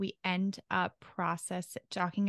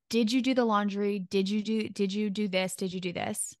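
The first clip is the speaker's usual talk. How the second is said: demanding check-up. Shown as a demonstration. Partner checking up on laundry, demanding. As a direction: grill them with repeated 'did you'. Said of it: "just listen to the smallest shift".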